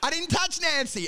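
high-pitched mock denial; hammed-up defensive protest; protesting innocence. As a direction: plead innocence as the accused squatter. Acted accused man protests his innocence.

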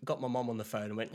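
ordinary talk

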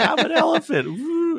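put-on very high, playful child voice; high cartoon kid with a whoop; little-kid character voice. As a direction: pipe it up like a silly little boy. Voicing little Gregory in costume.

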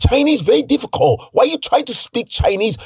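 high mock Chinese accent. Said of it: "You know, Chinese".

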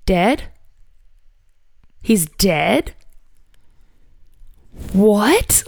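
halting questions that rise at the end; stunned disbelief. Retold or imitated. Reliving the news of a loved one's death, stunned disbelief. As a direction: relive the shock, each question after a long pause. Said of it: "sadness and disbelief and shock".

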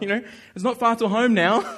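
amused half-panic about the nearly finished ride; laughing. Retold or imitated. Running out of time, laughing.

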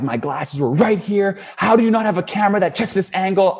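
shouting at store staff over stolen sunglasses, in an angry rant; retold angry yelling. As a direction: replay the outburst at the store clerk. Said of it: "I'm yelling at people".

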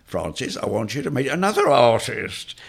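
mock introduction climbing high and loud; Ian Board. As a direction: imitate a mischievous introduction, swelling on the last words. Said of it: "he introduced me every time".

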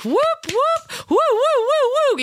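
high, slow whooping cheers; mock cheering. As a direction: imitate a crowd hollering with fists up. Agents cheering her on.

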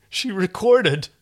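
drawn-out stunned disbelief; shocked disbelief. A narrator's reading. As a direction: say it slowly, unable to believe it. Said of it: "John muttered in shock and disbelief".

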